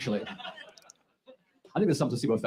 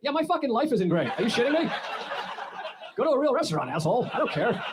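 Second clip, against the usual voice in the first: higher, snapping rant; mock angry worker; 9 semitones higher than usual. A fed-up McDonald's employee snapping at a complaining customer, mock angry. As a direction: tear into the customer.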